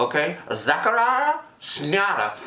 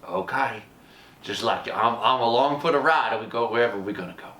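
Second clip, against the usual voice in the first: 1 resigned calm; 7 semitones lower than usual. Retold or imitated. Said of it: "he goes".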